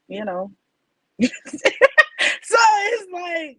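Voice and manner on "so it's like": laughing. Real, not acted. Laughing at the awkward story.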